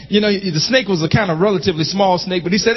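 normal talking voice

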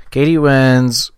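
loud, slow sarcastic cheer; loud mock gloat. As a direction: crow it out loud in mock triumph.

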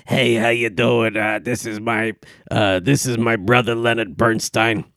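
put-on a loosely done old borscht-belt comic voice; bad Henny Youngman impression. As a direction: lightly ham up an old stand-up comic. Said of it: "not a very good Henny Youngman impression".

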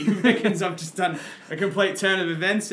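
laughing. Real, not acted. Laughing about dad's remark.

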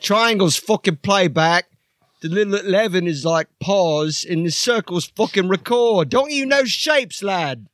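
high, shouty bossy bloke yelling at the lads; Archie character voice. Acted. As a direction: bark orders at the crew in a high put-on voice. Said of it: "This is Archie".

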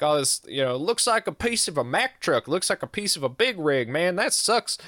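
lightly imitated twang, rising high in places; twangy accent. Stranger inspecting a piece of truck debris, twangy accent imitation. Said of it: "twangiest accent you ever heard".

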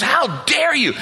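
high-pitched, hammed-up outraged shout; furious wife. Wife ripping into her husband, imitated furious shout. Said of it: "Just rips into me".

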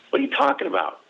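very high, incredulous question; acted bafflement. Son answering mom's news about a letter, acted incredulity. Retelling his baffled reply to mom.

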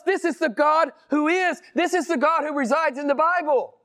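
very high, insistent pulpit proclamation; impassioned preaching. Real, not acted. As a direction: proclaim it to the congregation at a soaring pitch. Preaching at full force.

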